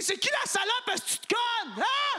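very high furious shriek; high shrieking yell. Retold or imitated. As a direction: scream it in a high voice. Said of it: "de me retenir de crier".

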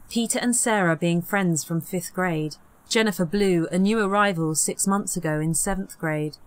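usual speaking voice

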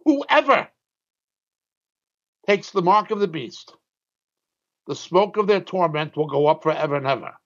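grave, emphatic reading; scripture quote. Acted. Preacher quoting the Bible's warning to his listeners, grave.

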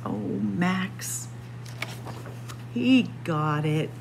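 ordinary delivery, nothing marked

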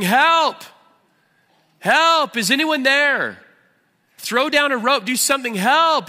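high, loud, desperate cries; yelling for help. Acted. Jack hanging off a ledge, yelling for help. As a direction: shout up for someone to rescue you. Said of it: "And he starts yelling".

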